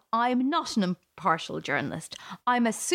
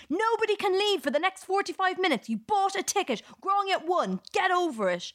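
mock scream; 9 semitones higher than usual. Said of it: "and scream".

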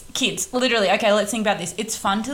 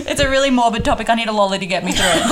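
fast, giggling through the words; laughing; 4 dB louder, faster than usual. Real, not acted. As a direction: laugh while rushing the line. Laughing over eating a lolly.

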